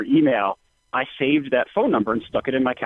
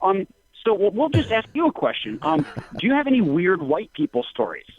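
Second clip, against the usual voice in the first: imitating a radio host; 8 semitones higher than usual. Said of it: "They're like".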